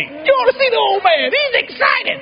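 very high, squealing mock report; retold excitement. Brother describes the newlywed father, acted excited squeal. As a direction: gush about the old man, comically.

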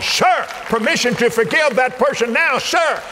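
eager recruit speaking up to God; mock soldier salute. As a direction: play a private asking permission. Soldier asks God permission.

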